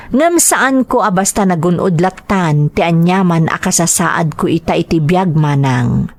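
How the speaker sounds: normal talking voice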